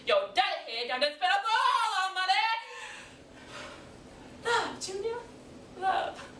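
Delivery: high, hammed-up wail; exasperated, high-pitched outburst; accusing wife. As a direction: shame your husband in front of the kids. Wife blames husband over money.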